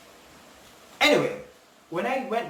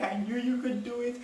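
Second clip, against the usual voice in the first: higher-pitched retold congratulation; happy coordinator; 7 semitones higher, 4 dB quieter than usual. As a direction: lightly imitate a thrilled, proud coordinator.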